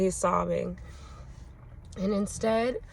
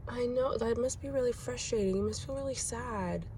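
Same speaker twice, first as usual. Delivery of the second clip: softer, higher, gentle comfort; soft reassuring empathy; 4 semitones higher, 4 dB quieter than usual. Retold or imitated. Mom comforting her four-year-old, soft and tender. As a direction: soothe him quietly while he calms. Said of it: "And I'm like".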